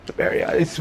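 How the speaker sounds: breathless whispered name; awed whisper. Real, not acted. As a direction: whisper it in disbelief. Awed after seeing new dinosaur.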